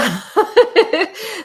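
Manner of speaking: high, loud giggle; real laugh. Real, not acted. Storyteller laughs after singing, real high giggle. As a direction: crack up at your own joke.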